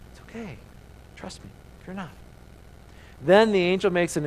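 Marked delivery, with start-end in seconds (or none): 0.1-2.1 soothing. Acted. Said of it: "Instead of scolding, there's comfort".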